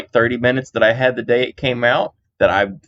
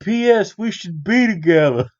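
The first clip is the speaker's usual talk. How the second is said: high, gushing superfan voice; gushing fan; 10 semitones higher than usual. Pretend. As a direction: gush like an obsessed superfan. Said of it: "fan speaks".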